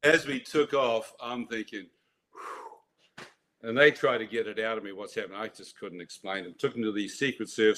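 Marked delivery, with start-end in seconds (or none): 2.3-3.3 relieved exhale. Retold or imitated. Relief after escaping the village.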